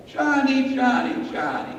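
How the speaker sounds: high, soft calling that drops away; gently summoning a grandson; Polish grandmother. Acted. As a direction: croon the name in a little high grandma voice. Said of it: "this is what she says".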